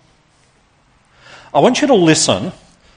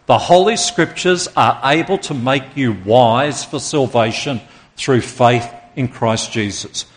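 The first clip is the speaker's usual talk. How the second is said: slow, measured recitation; spaced out for a congregation to follow; leading group recitation. Shown as a demonstration. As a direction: lead everyone in saying it together, deliberately. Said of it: "Let's say it together".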